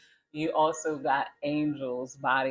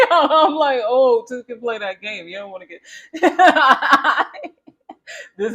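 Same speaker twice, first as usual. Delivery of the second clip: laughing; 10 semitones higher, 14 dB louder than usual. Real, not acted. Telling viewers her comeback while cracking up. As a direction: retort broken up by laughter, louder and higher.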